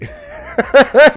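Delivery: sudden high burst of laughter; loud high laugh. Real, not acted. Laughs at the accent claim.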